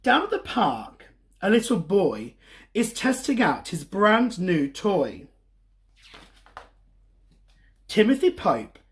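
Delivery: lively, sing-song storybook reading; reading to children. A narrator's reading. Storyteller reading a picture book to kids. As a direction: read it like story time for little ones.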